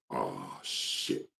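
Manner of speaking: low, very soft interjection. Retold or imitated. Imitating the singer cutting in on the remix, low and hushed. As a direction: drop deep and quiet under the music. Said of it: "you hear somebody come and say".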